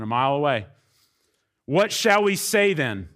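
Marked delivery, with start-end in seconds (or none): 1.6-3.1 slow, raised voice quoting Paul; reading Paul's question. Retold or imitated. Preacher reading Paul's question aloud. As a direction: voice Paul's rhetorical question slowly. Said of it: "Paul says".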